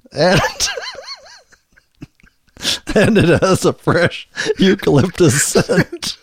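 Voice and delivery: giggling through the ad copy; cracking up mid-read; laughing. Real, not acted.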